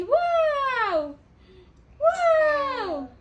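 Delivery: high, drawn-out wow; happy amazement. Shown as a demonstration. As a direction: demonstrate delighted surprise for a child. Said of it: "You look happy".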